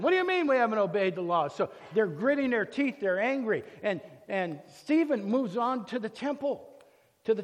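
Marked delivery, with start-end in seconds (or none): 0.0-1.5 fast, loud indignant retort; upset crowd. Acted. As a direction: fire it back as the offended crowd. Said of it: "now they're really upset at him".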